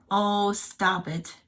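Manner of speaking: very slow, loud scold; slow drawn-out scolding. Real, not acted. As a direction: drag out the scold.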